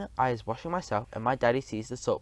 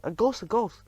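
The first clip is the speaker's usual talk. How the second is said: much higher mock cry of alarm; panicked yelling; 9 semitones higher than usual. A narrator's reading. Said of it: "everyone starts yelling".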